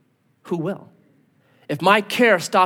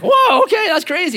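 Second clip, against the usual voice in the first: mock amazed whoa; 11 semitones higher, 4 dB louder than usual. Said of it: "the guy's like".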